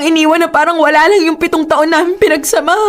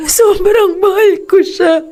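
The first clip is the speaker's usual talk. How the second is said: slow, tearful, laugh-cry break; 3 semitones higher, slower than usual. Acted. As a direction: confess it through tears. Girlfriend crying about her love. (mabagal, umiiyak na pag-amin)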